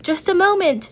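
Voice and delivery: high voice calling through the door; mild high call from the window. A narrator's reading. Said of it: "she cried".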